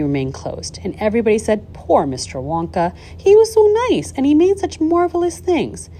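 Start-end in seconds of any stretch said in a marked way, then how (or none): 1.7-5.9 lightly acted, high-pitched lament; pitying townsfolk. A narrator's reading. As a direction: gush with pity for the poor man. Townspeople lamenting Wonka, voiced by narrator.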